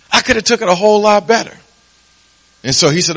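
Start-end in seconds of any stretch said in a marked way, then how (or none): 0.1-1.6 high, fast, emphatic punchline; exasperated wife. Retold or imitated. Wife scolds husband about tact, high fast emphasis. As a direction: fling the line out high and quick. Wife's punchline of her lesson.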